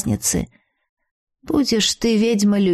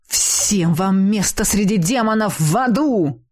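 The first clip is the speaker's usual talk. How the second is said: growled. Acted. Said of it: "рыкнул Кицуне".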